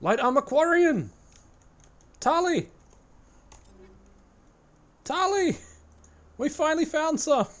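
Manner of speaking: high, loud excited cries; excited shouting. Real, not acted. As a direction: shout the discovery with delight. Excited find of armor.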